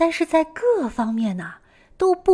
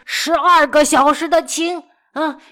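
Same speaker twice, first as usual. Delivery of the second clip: angry scolding; 4 semitones higher, 4 dB louder than usual. Retold or imitated. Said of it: "一边打骂贝多芬".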